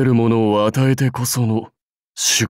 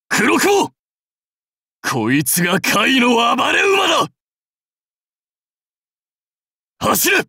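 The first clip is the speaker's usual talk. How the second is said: 高く張り上げた雄叫び; 愛馬に号令を叫ぶ; 武将の号令. Acted. 愛馬黒雲に号令をかける. (馬を駆る武将の叫び)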